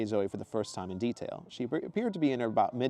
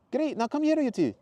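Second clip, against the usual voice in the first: much higher, louder cheerful woman's voice; Zoe's exclamation; 7 dB louder than usual. Acted. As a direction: greet him brightly and high. Zoe voiced.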